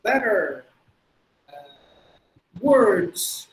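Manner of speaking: deliberate American "er" on each word; American R accent. Shown as a demonstration. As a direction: model the words with American rhotic R. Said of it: "So that's how they would say". (அமெரிக்க உச்சரிப்பு)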